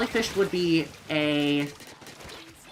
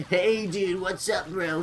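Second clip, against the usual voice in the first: laid-back surfer bro greeting; light surfer dude impression; slower than usual. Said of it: "surfer dude voice".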